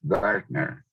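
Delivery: normal talking voice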